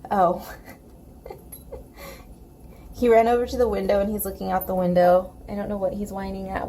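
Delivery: ordinary delivery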